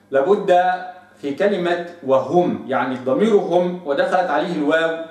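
usual speaking voice

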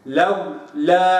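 لولا said very slowly with a soft, relaxed waw; soft waw demo. Shown as a demonstration. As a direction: linger softly on the waw of لولا. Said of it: "هكذا لابد أن نحقق رخوة الواو".

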